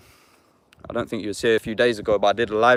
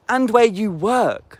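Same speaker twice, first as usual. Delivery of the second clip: slow taunt; 9 semitones higher, 4 dB louder, much slower than usual. Real, not acted. Taunting troll, slowed.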